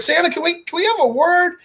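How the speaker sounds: high, pleading hero voice; acted tentative request. Heroes approach raging villain for a talk, acted high tentative voice. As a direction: pipe up carefully, pitched well up.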